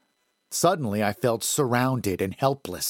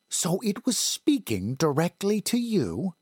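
lightly raised woman's voice, curious; a hint of an older woman, questioning; 9 semitones higher than usual. A narrator's reading.

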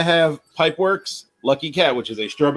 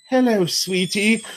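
imitating her line; 6 semitones higher, slower than usual. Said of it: "she looks me dead in the face and goes".

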